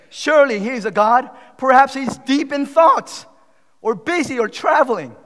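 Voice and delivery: high, ringing mockery of a rival god; loud taunt. Elijah making fun of Baal's prophets, shouted taunts. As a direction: shout the sarcastic suggestions. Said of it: "Elijah began to taunt them".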